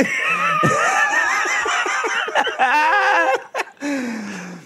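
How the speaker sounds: long high cackling laugh; wild rambling cackles. Real, not acted.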